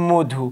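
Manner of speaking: মধু with closed "o", "mo-dhu"; as pronounced. Shown as a demonstration. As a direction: say মধু with rounded first vowel. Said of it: "তাহলে আমরা বলি".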